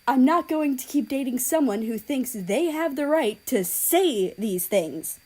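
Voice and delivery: angry breakup. Acted. Japan dumps South Korea, loud and angry. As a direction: end it with force.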